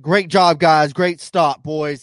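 loud, hearty praise for the crew; loud boss praise. Retold or imitated. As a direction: cheer the pit crew on like a driver on the radio.

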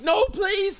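put-on high, pleading old-lady voice; frightened old woman. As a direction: beg like a terrified elderly woman. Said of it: "She's like".

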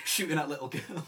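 loud laughing words. Real, not acted. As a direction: laugh through it. Laughing at the game joke.

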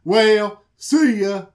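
loud, sulky farewell; mock huffy Laban. Laban leaving in a huff, loud then self-pitying. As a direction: flounce off sulking. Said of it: "Laban's like".

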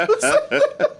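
high-pitched giggly disbelief; high laughing squeal. Real, not acted. Repeated question in disbelief.

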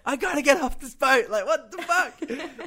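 high, exasperated mock yell; imitated frantic outburst. Musician imitating his manager calling from a boat, high exasperated outburst. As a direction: act out a caller losing it, half laughing.

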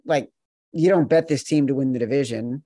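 ordinary talk

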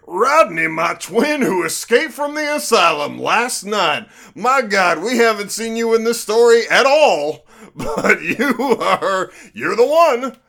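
high, loud soap-opera announcement dissolving into laughter; over-the-top melodrama, cracking up at the end; hammed-up dramatic reveal. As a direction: play the shock reveal for laughs. Mock dramatic twin reveal.

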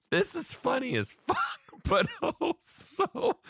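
high, squeaky words breaking into laughter; tearful laughter. Real, not acted. Teacher overcome by the kids' sweetness, laughing tearfully. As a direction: try to talk while cracking up. Cracking up while moved to tears.

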